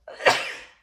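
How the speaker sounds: a real sudden sneeze. Real, not acted. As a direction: sneeze out loud. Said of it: "j'éternue".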